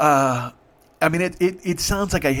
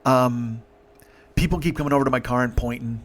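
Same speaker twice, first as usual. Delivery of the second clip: ordinary delivery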